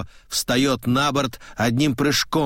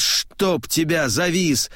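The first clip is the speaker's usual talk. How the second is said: higher, slow mild curse; cursing; 6 semitones higher, slower than usual. A narrator's reading. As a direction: grumble at the stuck sail. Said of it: "травит шкот и бранится". (сердитая брань на парус)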